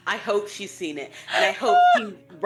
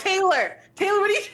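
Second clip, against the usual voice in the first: shocked exclaiming; 11 semitones higher, 4 dB louder than usual. Retold or imitated. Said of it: "she kept being like".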